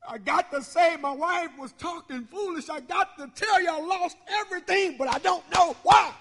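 building from complaint to an anguished cry; anguished Job, rising to a shout; Job's bitter complaint. Acted. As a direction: Job crying out that he lost everything.

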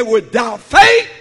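slow, loud shout on the verse; shouted scripture. Real, not acted. Preacher crying out Hebrews to the church, slow shout.

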